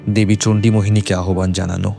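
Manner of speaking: plain reading voice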